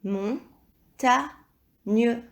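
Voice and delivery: Montagne. slowly broken in three; syllable by syllable. Shown as a demonstration. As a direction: repeat "Montagne." as mon, ta, gne. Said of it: "ou troisième syllabe".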